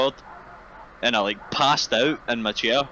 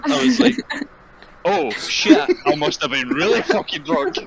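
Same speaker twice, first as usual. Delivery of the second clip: laughing; 8 semitones higher, 4 dB louder than usual. Real, not acted. Laughing while telling punchline.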